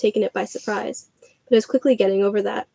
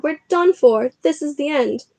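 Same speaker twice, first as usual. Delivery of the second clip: higher, hopeless wail; despairing; 5 semitones higher than usual. Acted. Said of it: "Ron moaned".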